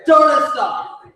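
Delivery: high-pitched rallying shouts; hype emcee. Acted. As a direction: whip up the crowd, stretch out the final word. Emcee rallies crowd before poem.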